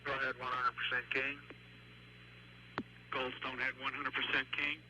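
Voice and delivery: tinny voices over the loop; radio chatter. Real, not acted. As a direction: relay checks over the radio. Station radio checks.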